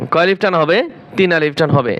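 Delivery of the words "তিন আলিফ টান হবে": ordinary delivery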